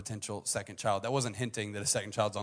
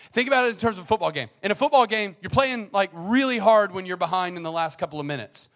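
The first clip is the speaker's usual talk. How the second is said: loud, high, impassioned preaching; preaching at full force; 8 semitones higher, 11 dB louder than usual. Acted. Preacher urging the congregation, loud and impassioned. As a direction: drive the point home to the crowd.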